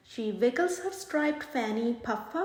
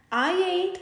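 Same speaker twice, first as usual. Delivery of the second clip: smirking; 5 semitones higher, 5 dB louder than usual. Acted. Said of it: "smirks Monsieur Otter".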